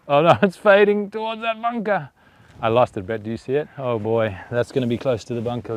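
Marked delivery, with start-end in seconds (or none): 0.1-2.1 dismayed. Real, not acted. Watching his shot fade toward the bunker.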